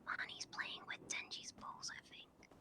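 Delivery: hushed whisper while spying; worried whisper. Pretend. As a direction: whisper so nobody overhears. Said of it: "I'm spying on my family".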